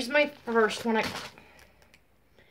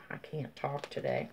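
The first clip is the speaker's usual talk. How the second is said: ordinary delivery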